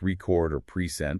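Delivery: "record" and "present" stressed on the second syllable. Shown as a demonstration. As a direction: verb forms, weight on the end of "record" and "present". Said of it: "often stress the second syllable".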